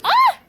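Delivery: sudden sharp high scream of annoyance; frustrated scream. Real, not acted. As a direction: let out a fed-up shriek. Little brother keeps interrupting filming.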